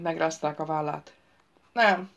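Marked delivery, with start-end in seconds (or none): none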